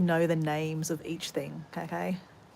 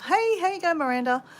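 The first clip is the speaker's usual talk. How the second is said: bright high greeting to a neighbour; calling out hello; 8 semitones higher, 4 dB louder than usual. Real, not acted. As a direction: call out cheerfully across the yard.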